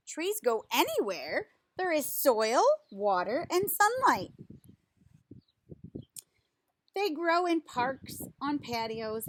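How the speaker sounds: slow, lively picture-book reading voice; reading to children. Acted. Reading a tree picture book to children, lively storytime voice. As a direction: read it out like a storytime for little kids.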